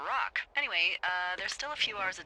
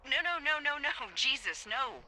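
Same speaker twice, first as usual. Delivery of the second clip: high rapid-fire string of protest, easing down; alarmed refusal; 7 semitones higher than usual. Acted. Boss rejects his idea of beating them up, alarmed. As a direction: cut off the bad idea at once.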